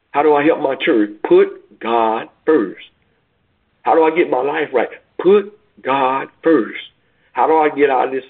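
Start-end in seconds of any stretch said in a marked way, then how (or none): 1.3-2.8 very slow, each word weighed; preacher's slow refrain, Put God first; slow emphatic refrain. Acted. 5.2-6.8 very slow, each word weighed; preacher's slow refrain, Put God first; slow emphatic refrain. Acted.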